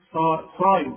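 صايم with a thick, heavy ص, never سايم; emphatic sad. Shown as a demonstration. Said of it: "مش سايم بقى".

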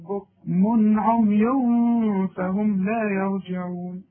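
بكم عمي slow with the tanween clearly said; clear recitation. Shown as a demonstration. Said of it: "شوف اسمعها". (إظهار التنوين في بكم عمي)

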